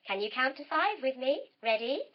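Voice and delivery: ordinary delivery, nothing marked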